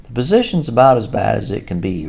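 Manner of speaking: grumpy and loud. Acted. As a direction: grumble it out, loudly. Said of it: "replied the Mole grumpily".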